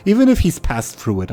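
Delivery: usual speaking voice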